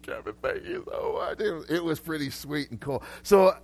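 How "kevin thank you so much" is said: high, squeaky mock-tearful voice; tearful thanks, imitated. A crying barista hugs and thanks the preacher, imitated tearful squeak. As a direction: imitate her overcome with thanks, pitched way up. Grateful crying woman being retold.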